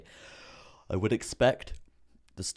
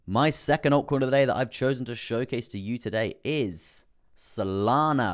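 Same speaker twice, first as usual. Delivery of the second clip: hyped reveal; 4 semitones higher, 5 dB louder than usual. Acted. Hype reveal of the coin.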